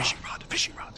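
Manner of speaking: whispered prompt; feed the line in a whisper. Real, not acted.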